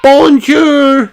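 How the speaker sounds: high, loud squeal of "Bonjour"; excited squeal. Acted. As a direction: squeal with delight. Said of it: "squeals Peppa".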